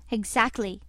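ordinary delivery, nothing marked

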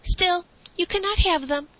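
firm and high-pitched; stout declaration. A narrator's reading. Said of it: "declared the boy stoutly".

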